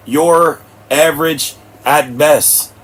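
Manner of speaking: dragged-out slow delivery; slow, deliberate put-down. Real, not acted. Telling viewers they're average, said very slowly. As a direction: let each word land slowly.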